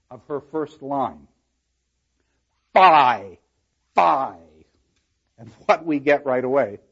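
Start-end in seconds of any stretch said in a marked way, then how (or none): 2.7-4.6 "Fie, fie" both loud and drawn out, falling; two equally forceful beats on "Fie"; two accented syllables. Shown as a demonstration. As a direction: hit each "Fie" hard with a pause between. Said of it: "two accented syllables".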